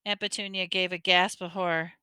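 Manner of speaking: ordinary delivery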